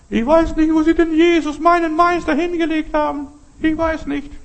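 very high, loud lamenting voice; distraught Mary Magdalena. Acted. Mary telling the gardener her master is gone, high distraught wail.

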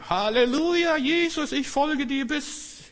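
hammed-up high, loud cry of devotion; fiery zeal. Preacher mimicking short-lived new believers, exaggerated ecstatic shout. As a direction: belt it out like an over-eager convert, stretching the end. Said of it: "Die sind manchmal Feuer und Flamme".

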